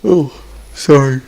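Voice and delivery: words stretched out by a yawn. Real, not acted. Coach mid-commentary apologizing while yawning, talking through a yawn. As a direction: talk through a big yawn. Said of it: "I had to yawn".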